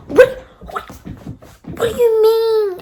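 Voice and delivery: outraged disbelief. Retold or imitated. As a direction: shriek it out, scandalised and slow.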